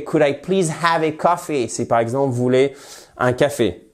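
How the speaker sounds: ordinary talk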